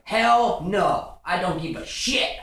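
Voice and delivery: loud, high-pitched outburst; snapping. Retold or imitated. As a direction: act out someone snapping in angry refusal. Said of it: "you gonna snap in".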